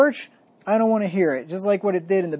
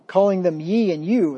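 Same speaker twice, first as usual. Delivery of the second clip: ordinary talk, nothing marked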